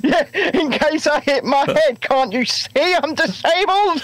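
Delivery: laughing mock plea. Joking reply at security.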